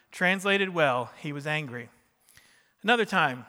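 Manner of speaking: ordinary talk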